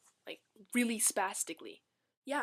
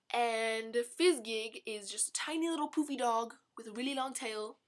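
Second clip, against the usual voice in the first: ordinary talk, nothing marked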